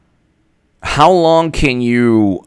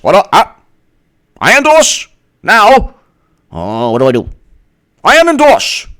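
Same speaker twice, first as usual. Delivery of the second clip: high, louder flustered dithering politician voice; mock Bernie; 5 semitones higher, 3 dB louder than usual. Mocking Bernie flip-flopping on endorsements, flustered high voice. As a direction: play him as panicked and wishy-washy.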